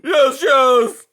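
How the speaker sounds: loud, high crying repeats; tearful wailing. A narrator's reading. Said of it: "cried Aerith".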